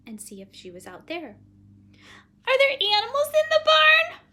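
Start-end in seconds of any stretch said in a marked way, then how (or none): 2.5-4.2 very high, loud question; anxious little girl. Acted. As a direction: ask in a high, worried child voice. Child nervously asks about barn.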